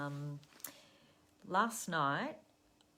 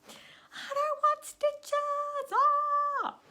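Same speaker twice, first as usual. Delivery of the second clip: high, much louder, slow panicked wail; panicked child's scream; 12 dB louder, much slower than usual. Retold or imitated. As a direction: scream like a terrified nine-year-old. Son panicking about stitches.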